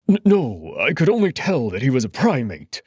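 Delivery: flustered and scared, tripping over words; stammering fear. Acted. Felix stammering nervously before the emperor.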